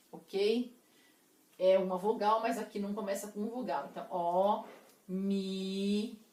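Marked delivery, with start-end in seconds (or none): 4.1-6.2 "ó, mi" as separate, held syllables; "ó", pause, drawn-out "mi". Shown as a demonstration. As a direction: split off each syllable, "ó", then "mi". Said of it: "como se eu estivesse separando sílaba".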